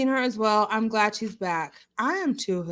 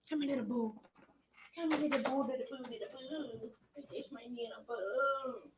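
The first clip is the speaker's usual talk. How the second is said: soft cooing; 15 dB quieter than usual. Real, not acted. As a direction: coo softly to the dog. Cooing to dog softly.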